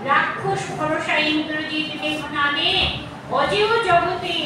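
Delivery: usual speaking voice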